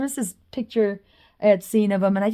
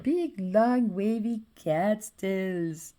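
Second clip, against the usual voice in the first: dreamy drawn-out; much slower than usual. Acted. Describing drawing.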